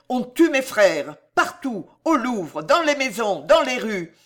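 desperate high-pitched shouting; roaring. A narrator's reading. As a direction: roar out the alarm and plead for help. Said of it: "rugit la Trémouille".

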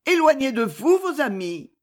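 voix de femme plus aiguë; femme suppliante. A narrator's reading. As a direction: plaider avec insistance, voix haut placée. Clémence plaide son départ. (prière féminine, ton aigu)

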